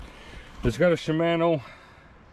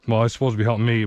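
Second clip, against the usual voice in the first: normal talking voice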